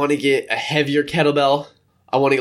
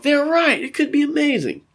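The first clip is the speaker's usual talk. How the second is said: high, delighted realization; thrilled fisherman; 13 semitones higher than usual. Retold or imitated. Fisherman after the first catch, high and thrilled. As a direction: gasp it out like a happy fisherman. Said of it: "After the first one you hook off".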